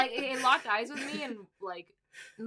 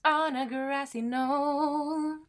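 announcer voice; 4 semitones higher, much slower than usual. Pretend. Dramatic show title announcement.

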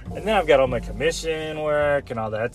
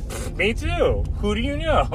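mock scoff; 10 semitones higher than usual. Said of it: "And I'm like".

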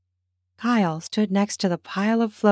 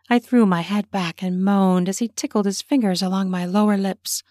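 plain reading voice

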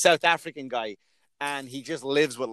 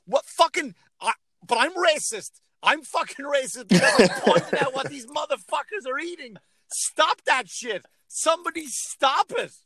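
indignant rant; 9 semitones higher than usual. Retold or imitated. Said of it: "I'm like".

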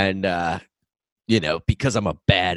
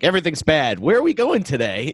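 higher, breezy mock cheer; bright carefree forties voice; cheerful forties self; 7 semitones higher than usual. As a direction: chirp it like nothing matters. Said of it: "you're just gonna be like".

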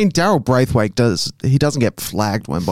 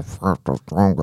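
robot voice; 7 semitones lower, 4 dB quieter than usual. Pretend. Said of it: "The robot voice".

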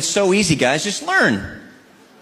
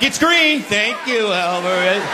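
mock grateful husband; 4 semitones higher, 3 dB louder, much slower than usual.

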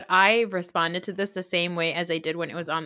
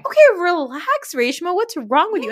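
exasperated retort; 9 semitones higher, 5 dB louder than usual. Retold or imitated. Host reacting to a mean cast member, exasperated retort. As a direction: act out telling someone to calm down. Host snaps back at absent villain.

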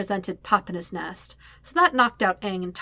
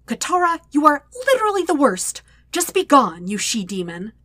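exasperated; 6 semitones higher, 5 dB louder than usual. A narrator's reading. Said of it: "Sokka scowled right back at her".

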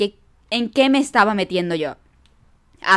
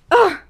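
frustrated cry; 9 semitones higher, 8 dB louder than usual. Real, not acted. Frustrated cry at own choices.

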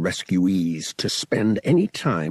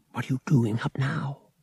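puzzled half-whisper, pitched up; 4 semitones higher than usual. A narrator's reading. Boy asking his late-night visitor, hushed and puzzled. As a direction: ask it hushed and baffled.

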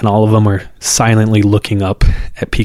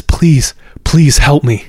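higher-pitched, urgent retold begging; imitated desperate cry for help; frantic plea; 5 semitones higher, 4 dB louder, slower than usual. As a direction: act out a terrified woman pleading for help. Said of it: "she's frantic".

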